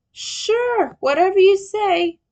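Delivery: high, loud teasing tone. A narrator's reading. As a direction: brush it off playfully. Leo teasing.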